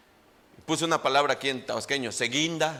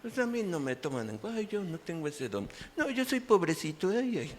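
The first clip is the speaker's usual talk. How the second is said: lightly voiced whiny victim, much softer; mock self-pity, hushed and sighing; self-pitying foot; 3 dB quieter than usual. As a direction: whimper it like a poor little victim. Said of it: "ese pie victimista".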